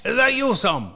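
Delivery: imitating an eager Scottish caller; Scottish accent. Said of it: "He's Scottish".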